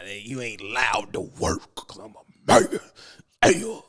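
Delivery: slow, lightly voiced bossy husband; a hint of a domineering man laying down the rule; mock macho husband. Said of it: "they super Negroes now".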